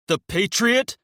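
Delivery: high, loud incredulous spit; spitting anger. Acted. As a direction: spit the name out in fury. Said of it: "Emil spits".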